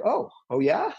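defiant rebel. Retold or imitated. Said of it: "the inner rebel is like".

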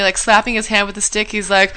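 ordinary delivery

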